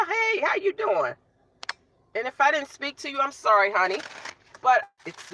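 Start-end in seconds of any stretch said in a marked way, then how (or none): 0.1-1.1 sing-song greeting. Real, not acted. Greeting viewer in live chat.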